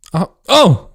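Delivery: loud, hammed-up yelp of surprise; mock startled cry. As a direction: pretend to trip, crying out. Acted fall in improvised erotica.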